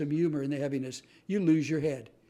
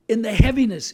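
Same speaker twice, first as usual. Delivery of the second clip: loud emphasis on heaviness; 6 semitones higher, 11 dB louder than usual. Acted. Punching key word in sermon-like talk.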